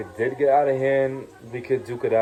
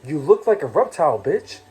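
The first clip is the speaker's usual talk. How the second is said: heated insults; 5 semitones higher than usual. Real, not acted. Heated rant insulting Lilo.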